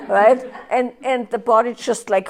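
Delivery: ordinary talk, nothing marked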